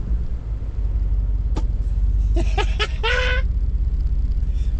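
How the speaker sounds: very high gleeful laugh; squealing laugh. Real, not acted. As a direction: squeal with glee. Excited laugh at rev.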